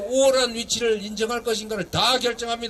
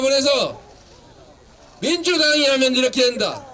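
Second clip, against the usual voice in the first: rousing shout; 3 semitones higher than usual. Real, not acted. Politician rallying Cheongju citizens behind a candidate, shouting. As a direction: shout out a promise to fire up the crowd. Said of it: "이제 소리 지르는 건 그만하고".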